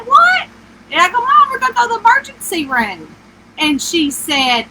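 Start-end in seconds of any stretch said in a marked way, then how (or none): 0.1-3.1 high-pitched, disbelieving retort to mom; retold outburst, pitched way up; incredulous protest. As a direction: argue back in baffled disbelief.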